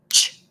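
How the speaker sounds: "ch" short and hard; a crisp clipped "ch"; short strong ch. Shown as a demonstration. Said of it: "fuerte y es corto". ("ch" corto y fuerte)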